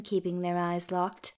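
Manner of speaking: plain reading voice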